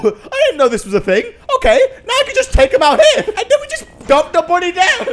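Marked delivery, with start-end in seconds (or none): 1.6-5.1 much higher, giggling through the words; delighted laughter at the game; laughing. Real, not acted. Gamer laughing as the takedown works.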